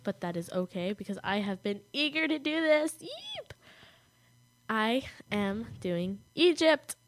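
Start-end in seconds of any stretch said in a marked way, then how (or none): 3.0-3.5 tiny, very high yelp; excited squeak. Real, not acted. Eager to do her topic at last, excited little squeak. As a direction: let out a soft high squeal of glee.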